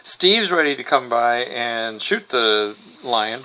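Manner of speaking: ordinary talk, nothing marked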